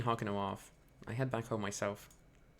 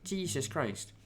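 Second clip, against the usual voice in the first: higher and louder, rising outrage; indignant rant; 8 semitones higher, 6 dB louder than usual. Real, not acted. Streamer ranting at the game's characters. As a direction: demand answers in disbelief.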